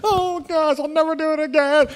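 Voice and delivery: put-on high, contrite little girl voice; remorseful little girl. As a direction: play the sorry daughter. Daughter instantly repents.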